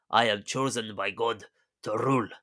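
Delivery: mock Russian accent that sounds Spanish; Russian accent slipping into Spanish. Nicholas parroting his dad, botched Russian-Spanish accent. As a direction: attempt Russian, drift towards a Spanish accent. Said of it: "I don't know why I'm so Spanish".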